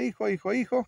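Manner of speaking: loud, rising mock call; mock mother's cry. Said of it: "La mamá está". (grito fingido de mamá, fuerte y agudo)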